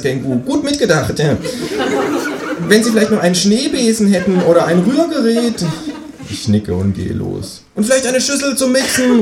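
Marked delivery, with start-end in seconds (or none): 0.4-5.6 much higher chatty kangaroo neighbour; a cheeky kangaroo asking for eggs. Acted. Kangaroo voiced in read story. 7.8-9.2 much higher kangaroo calling out; kangaroo calling after. Acted. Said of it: "ruft mir das Känguru hinterher".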